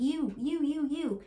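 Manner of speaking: a light, hammed-up accusation; mock finger-pointing. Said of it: "being like".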